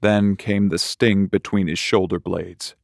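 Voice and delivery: ordinary delivery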